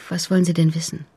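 ordinary talk

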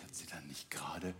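suspenseful whisper. A narrator's reading. Suspense, unvoiced.

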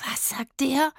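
high-pitched outraged question; affronted disbelief; indignant. A narrator's reading. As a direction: ask it deeply offended. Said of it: "fragte Rufus empört".

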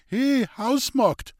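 high, slow call across the house. Acted. Old man in bed calls the maid in the hallway, high and drawn out.

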